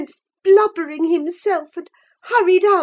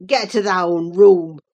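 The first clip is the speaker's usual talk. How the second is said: low, rough-voiced furious Earnshaw; deep angry Yorkshire man; inarticulate with passion; 11 semitones lower, 3 dB louder than usual. A narrator's reading. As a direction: growl it out low and choked with rage. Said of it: "in a voice almost inarticulate with passion".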